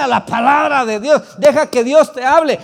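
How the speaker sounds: loud, high fiery sermon call; preaching at full force. Acted. Preacher exhorting congregation, loud fiery preaching. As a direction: proclaim it to the whole church.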